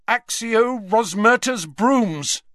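loud, slow spell incantation; commanding spell cast. Acted. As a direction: cast it with force. Harry casting a summoning spell.

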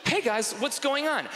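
high, eager greeting; put-on keen college kid; chirpy student. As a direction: burst in on the roommates in a high voice. His imagined self enters the dorm.

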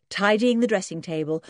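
usual speaking voice

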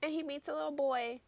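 ordinary talk

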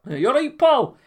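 a high, loud Glasgow greeting; Glaswegian accent. Shown as a demonstration. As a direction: show him how it's said in Glasgow.

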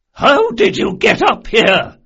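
high, slow, loud demand; outraged shout. A narrator's reading. Old noblewoman rising from her seat at intruders, shouting.

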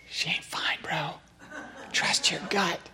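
a hushed bro-to-bro tip, no voice; conspiratorial whisper. Acted. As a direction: whisper it to the husband like a warning. Whispered aside to husband.

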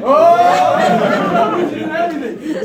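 loud, high, drawn-out mock celebration; hammed-up high whoop; mock cheering. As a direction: cheer absurdly over nothing. Mock celebration of someone merely breathing.

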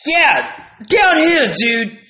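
high, loud call down to the driver; mock shout to the pizza guy below. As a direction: yell down the breezeway.

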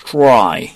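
"try" drawn out slowly with an English R; R pronunciation. Shown as a demonstration. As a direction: model "try" slowly, TR run together. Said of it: "R 있으니까 발음이".

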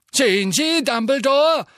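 high, loud, sneering portrait voice; a sly old headmaster mocking Dumbledore. A narrator's reading. Phineas sneers slyly at Dumbledore, high and loud. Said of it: "sagte Phineas Nigellus hinterlistig".

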